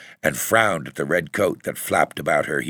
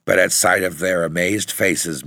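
usual speaking voice

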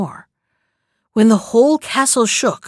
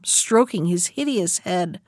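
plain reading voice

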